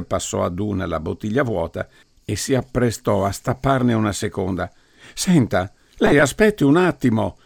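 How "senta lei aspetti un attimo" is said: higher, louder stern call; ringing command. A narrator's reading. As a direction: ring out like judgement day. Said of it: "squillò la voce del giudizio universale".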